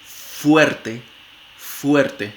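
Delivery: "fuerte" with a clear lip-teeth f; labiodental f in fuerte. Shown as a demonstration. Phonetics teacher modelling the lip-teeth f in fuerte. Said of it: "como en f".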